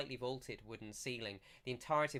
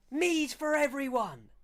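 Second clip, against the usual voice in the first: celebratory call; 11 dB louder than usual. Acted. Villager calling drinks for the cheering crowd, triumphant high shout. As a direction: proclaim it to the whole hall. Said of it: "looks back to the crowd and goes".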